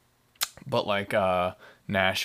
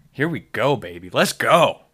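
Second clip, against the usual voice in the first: hyped up; 2 semitones higher, 7 dB louder than usual. Real, not acted. Hyping himself up.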